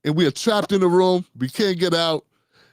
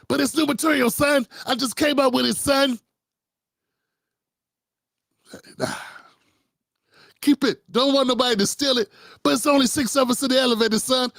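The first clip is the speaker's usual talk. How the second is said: much higher, pleading comic voice; Donnell impression; 7 semitones higher than usual. Donnell insisting on new material in the elevator. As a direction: beg to tell the jokes, pitched up. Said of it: "Donnell's like".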